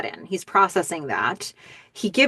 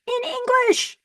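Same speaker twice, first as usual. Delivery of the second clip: much higher, amazed repeat; excited; slower than usual. Real, not acted. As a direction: say it again, stunned and thrilled.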